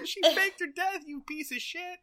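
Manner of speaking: high-pitched comic berating; hammed-up indignant insult; mock outrage. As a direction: tear into them in a high voice. Mirror Genie berating sitcom character.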